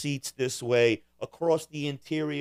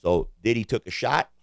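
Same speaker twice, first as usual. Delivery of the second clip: ordinary talk, nothing marked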